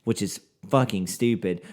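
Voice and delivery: normal talking voice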